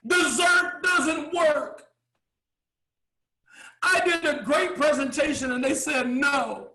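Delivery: mock outraged server whining to the boss; indignant waiter. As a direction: play a waiter storming in to gripe.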